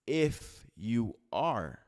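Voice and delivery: IF YOU ARE drawn out slowly, word by word; slow, deliberate "If you are"; emphatic quote. Retold or imitated. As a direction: stretch each of IF, YOU, ARE with weight. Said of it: "He didn't say, "If you are really".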